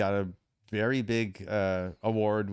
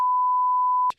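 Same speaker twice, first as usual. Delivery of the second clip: high laugh; 9 dB louder than usual. Real, not acted. Laughs mid-quote.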